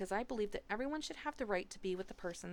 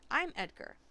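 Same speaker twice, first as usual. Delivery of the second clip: lightly mimicked American accent, pitched up; flat American accent; 6 semitones higher, 4 dB louder than usual. A narrator's reading. New boy copying his classmates' speech, flat American accent. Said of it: "mimicking the flat American sounds".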